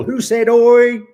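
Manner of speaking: high, loud mock outburst; imitated angry nan. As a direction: boom it like a table-thumping nan. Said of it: "She would hammer the table".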